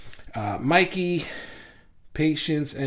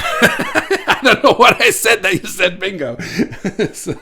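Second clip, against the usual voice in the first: laughing; 7 semitones higher, 6 dB louder than usual. Real, not acted. Confused by Richard's bingo comment.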